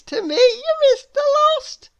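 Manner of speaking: cheerfully refuse, very high; contrary Miss Contrary. Acted. Miss Contrary answers Mister Small, very high sing-song. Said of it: "she said".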